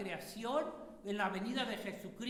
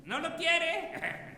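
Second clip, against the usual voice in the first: louder, higher and slow mock question; teasing question; 6 semitones higher, 7 dB louder, much slower than usual. Real, not acted. Preacher teases the boys about separating soul, loud and slow.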